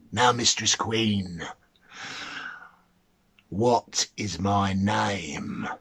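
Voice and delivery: mannikin taunting. Acted. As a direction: draw it out low, sure he has won. The mannikin demands his name.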